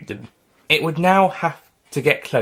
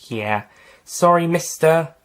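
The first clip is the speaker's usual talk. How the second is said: ordinary delivery, nothing marked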